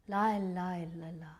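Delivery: low refrain fading away softly; hushed refrain. Acted. Mother overwhelmed while driving the kids, hushed refrain. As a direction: murmur it to steady yourself. Same refrain at sight of police.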